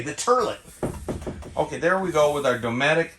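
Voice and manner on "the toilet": "turlet" said with an "ur" sound for toilet; Archie Bunker's turlet. Retold or imitated. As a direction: say "turlet", rhyming with "hurl it". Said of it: "as Archie Bunker used to say".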